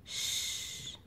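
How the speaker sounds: "ch" as a long voiceless hush; isolated sound. Shown as a demonstration. Teacher counting sounds for pupils, sustained "ch". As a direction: hold "ch" alone, softly, with no vowel. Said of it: "On entend un premier rond pour".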